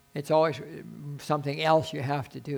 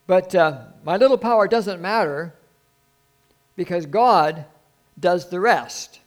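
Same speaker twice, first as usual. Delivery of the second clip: loud, high, drawn-out proclamation; raised pulpit voice, slow and emphatic; full-force preaching; 4 semitones higher, 9 dB louder than usual. Acted. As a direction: preach it out big, stretching the key words.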